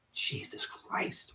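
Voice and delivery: a stunned, hushed Jesus Christ; awed whisper. Real, not acted. Whispering in awe at a grandfather's smoking record. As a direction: breathe it out in a whisper.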